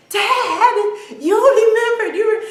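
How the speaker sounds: high, loud, overjoyed exclamation; moved daughter. Retold or imitated. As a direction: imitate a daughter touched that Dad remembered. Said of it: "you can see her eyes well, welling up".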